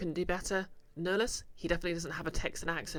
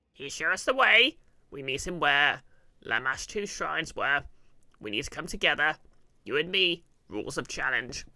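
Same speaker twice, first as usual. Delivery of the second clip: rally your ally as a loud goblin; resolute goblin; 8 dB louder than usual. Acted. Goblin calling his ally to the challenge, loud and resolute.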